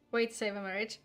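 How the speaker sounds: normal talking voice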